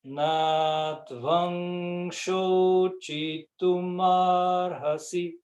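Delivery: Na tvam shochitum arhasi said very slowly; slow careful Sanskrit line; slow recitation. Shown as a demonstration. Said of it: "Agora a linha inteira".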